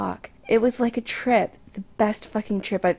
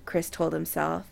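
ordinary delivery, nothing marked

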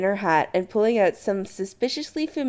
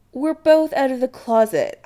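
higher, louder puzzlement; puzzled; 7 semitones higher, 5 dB louder than usual. Acted. Adam to Chris over a misunderstanding, puzzled. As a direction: say it puzzled, pitch lifted.

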